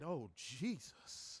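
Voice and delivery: swooning under his breath; breathless oh Jesus. Retold or imitated. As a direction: let it slip out, overwhelmed. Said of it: "I said".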